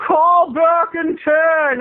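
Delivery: high, slow mock boyfriend voice; imitating Sean. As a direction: drawl it out as the boyfriend. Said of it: "Sean's like".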